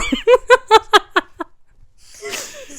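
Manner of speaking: loud, high-pitched burst of laughter. Real, not acted. Friend cracking up at her Willow joke. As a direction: shriek with laughter.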